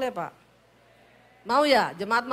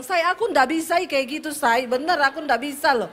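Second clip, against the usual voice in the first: stressed protest; 2 semitones higher, 5 dB louder than usual. Retold or imitated. Stressed wife telling her husband she can't talk that way, loud pleading. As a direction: insist you really can't do it. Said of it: "saya stres juga".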